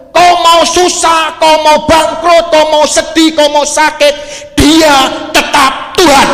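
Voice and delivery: preacher shouting his point home; full-force preaching. Acted. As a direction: thunder it out to the congregation.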